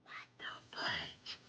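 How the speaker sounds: very slow, hushed whisper; barely audible shock; stunned whisper. Real, not acted. Stunned, under the breath.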